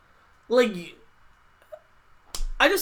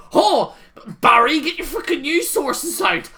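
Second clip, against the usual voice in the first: louder, higher exasperated rant; exasperated outburst; 6 semitones higher, 4 dB louder than usual. Real, not acted. Ranting at a rumour reporter, loud and exasperated. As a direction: vent at him, loud and fed up.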